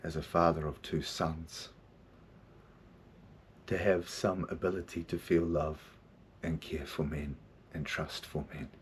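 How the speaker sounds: soft, low and heartfelt; quiet tribute to the men who shaped him; slowed down, sincere. Real, not acted. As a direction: take it down and speak from the heart. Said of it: "Let me slow the fuck down".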